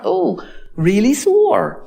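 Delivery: slow drawn-out ooh of pretend pain; mock pain wince. Probing a sore on the hand, mock pained wince.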